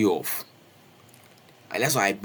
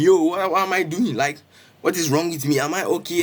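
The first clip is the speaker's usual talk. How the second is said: raised, higher voice, mock alarm; retold screaming; 6 semitones higher, 4 dB louder than usual. Crowd shouting, alarmed at his fighting, raised voice. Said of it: "they started screaming".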